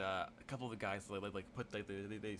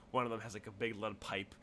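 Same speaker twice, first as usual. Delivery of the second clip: normal talking voice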